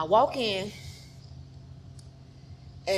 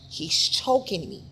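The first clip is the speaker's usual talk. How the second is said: ordinary delivery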